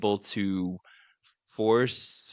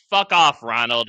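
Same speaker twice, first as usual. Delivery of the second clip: mock angry yell; 3 semitones higher, 10 dB louder than usual. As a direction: bark it at the pest. Mom yelling at Ronald.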